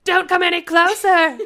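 slow, high, very loud warning; threatening shout. A narrator's reading. Robber swiveling his gun toward you, threatening shout. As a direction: shout them back. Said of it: "the man threatened".

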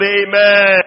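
very loud high amen; shouted amen. Acted. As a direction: push the crowd to shout. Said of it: "you shout".

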